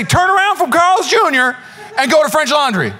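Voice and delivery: high, emphatic comic warning; urgent mock plea. Foodie begs friend to skip Carl's Junior, mock urgent. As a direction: plead as if lives depend on it. Acting foodie urging like Daniel.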